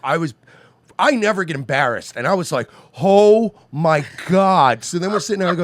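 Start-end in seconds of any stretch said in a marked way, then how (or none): 3.0-4.8 loud, high, hugely drawn-out words; re-enacted "oh my God" moment; mortified disbelief. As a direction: stretch each word in appalled amazement. Acts out his embarrassed reaction.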